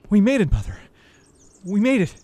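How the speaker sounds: high, loud panicked shouts; a scared boy shouting for his mother to run; frantic shouting. Acted. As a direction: yell in panic to save your mother.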